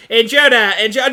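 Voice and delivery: lightly voiced alert robot; robot alarm. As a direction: sound the alarm like a cheap robot. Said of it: "eighties alert robot thing".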